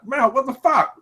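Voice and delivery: shocked high yelp. Pretend. Friend reacting to being set upon in a pub, high shocked yelp. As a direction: pitch jumps way up in shocked disbelief.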